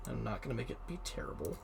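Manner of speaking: ordinary delivery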